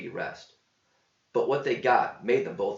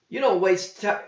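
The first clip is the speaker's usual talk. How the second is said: higher voice, stress on "don't", cut off; quip breaking off; 9 semitones higher, 6 dB louder than usual. A narrator's reading. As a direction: start the jab and stop. John quips, breaks off.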